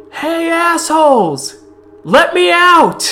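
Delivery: high, full-voiced yell from a cell; angry shout. Familiar voice yelling at captors to let her out, furious shout. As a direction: holler it, drawn out and high.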